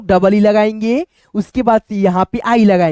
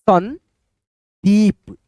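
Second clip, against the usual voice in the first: drawn out slowly; much slower than usual. Shown as a demonstration. Teacher spelling a name aloud, slowly drawn out. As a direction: stretch each sound of Sandeep very slowly. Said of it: "एक लिख दिया यहाँ पे हमने".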